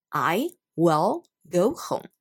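slow, careful "will" with a full final L; slow model reading. Shown as a demonstration. Teacher slowly modelling the L in "will". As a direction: spell out every sound slowly. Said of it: "正确的念法".

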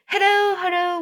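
high, loud calling out; shouting for a lost child. Acted. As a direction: call out high and loud as if searching the house. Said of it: "the house shouting".

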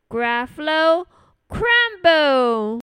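loud, confident announcement; bold mouse threat. Acted. Mouse threatens to eat the Gruffalo, bold and emphatic. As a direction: land both words firmly, with a falling finish.

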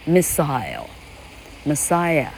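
"Missile" and "Messiah" said very slowly and softly, stress clearly placed; quiet, slow side-by-side stress demonstration; stress contrast. Shown as a demonstration. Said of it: "这是重音的差别而已".

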